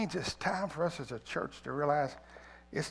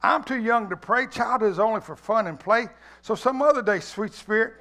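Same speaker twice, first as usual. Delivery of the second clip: put-on higher, louder childlike voice; child's reply; 6 semitones higher, 8 dB louder than usual. Child answering the Spirit, higher and carefree. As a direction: play the carefree child brushing him off. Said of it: "the child replied".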